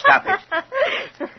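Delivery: high, harsh cry to silence her; sharp rebuke. Acted. Stepfather silencing the fortune teller's mockery, sharp. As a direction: cut her laughter off.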